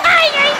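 comic high-pitched ow; high pained yelp. Retold or imitated. Client told to spread for waxer, high pained yelp. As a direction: yelp it high, hamming up the pain.

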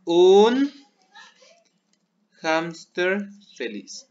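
un hámster feliz drawn out with a loud un; slow dictation. Shown as a demonstration. Teacher dictating the Spanish phrase to pupils. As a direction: spell it out slowly for the class. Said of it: "vamos a poner".

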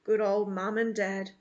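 plain reading voice

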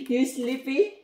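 normal talking voice

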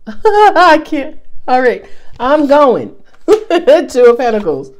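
real laughter breaking through, high and loud; helpless laugh in the voice. Real, not acted. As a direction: crack up and try to carry on. Cracks up at own guppy joke.

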